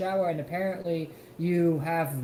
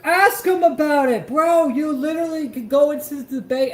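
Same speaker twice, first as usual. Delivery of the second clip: loud, higher, fed-up shouting; angry yell; 8 semitones higher, 10 dB louder than usual. Real, not acted. Shouting at the streamer in the clip to just ask, loud angry yell. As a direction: yell it at him, exasperated. Yelling at the clip's speaker.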